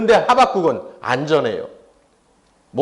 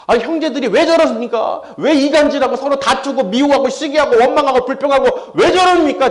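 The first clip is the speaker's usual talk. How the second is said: exasperated complaint; 6 semitones higher, 3 dB louder than usual. Acted. Voicing an exasperated complaint to God.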